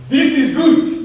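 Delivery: put-on very high, cheery African accent. Friend over the blown-off thumb, high African accent. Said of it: "in your best African accent".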